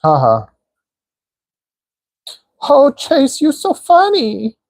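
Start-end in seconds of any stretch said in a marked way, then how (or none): 2.6-4.6 high, teasing mock Chinese accent. Streamer teasing a chatter in a high mock Chinese accent. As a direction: squeak the line in a put-on Chinese accent. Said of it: "why am I doing a Chinese accent".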